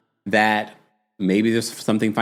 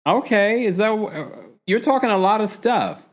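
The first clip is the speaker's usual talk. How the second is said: higher sassy reaction; sassy retort; 9 semitones higher than usual. Retold or imitated. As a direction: call her out with sass. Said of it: "I was like".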